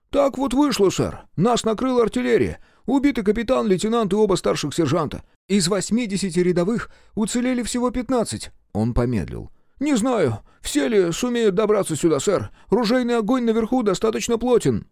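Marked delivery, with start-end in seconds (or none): 0.1-8.5 put-on high-pitched voice with an Irish accent; a lilting Irish soldier's report. A narrator's reading. Irish sergeant reports losses. 9.8-14.9 put-on high-pitched voice with an Irish accent; a lilting Irish soldier's report. A narrator's reading. Irish sergeant keeps speaking.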